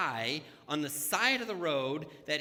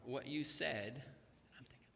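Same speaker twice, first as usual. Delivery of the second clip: ordinary delivery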